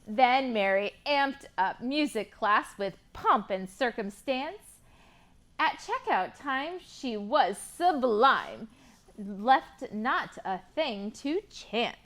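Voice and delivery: rhyming picture book read with lively lilt; reading to children. Acted. Teacher reading a rhyming book to kids, lively. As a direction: read the rhymes to a class of kids.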